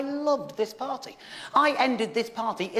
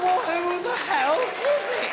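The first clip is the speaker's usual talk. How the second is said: exasperated question; 6 semitones higher, slower than usual. Retold or imitated. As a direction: drag it out in disbelief.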